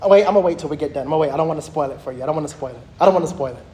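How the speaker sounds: low, hurried self-interruption; excited, tumbling low repeats; real excitement. Real, not acted. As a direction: rein yourself in, rushing under your breath. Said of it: "Can y'all tell I'm excited".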